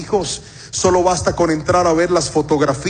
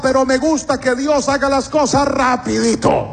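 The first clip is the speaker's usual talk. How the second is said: high, running list of devotion; mock-eager congregant, pitched high; eager believer; 7 semitones higher than usual. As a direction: pile up the pledges in a raised voice. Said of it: "Todos aquellos que dicen".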